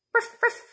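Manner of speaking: dog bark. Pretend. What does a dog say?.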